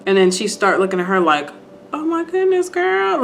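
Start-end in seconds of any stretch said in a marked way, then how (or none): 1.9-3.2 high, hushed amazement; mock astonishment. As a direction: imitate a sudden dawning realisation. Retold dawning realisation.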